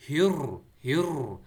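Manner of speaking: loud, drawn-out rolled R in ہر; slow forceful "hirr", tongue trilling; trilled ra. Shown as a demonstration. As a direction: hit ہر hard with a long vibrating R. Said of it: "را میں تھڑ تھڑاہٹ کی آواز آنی چاہیے".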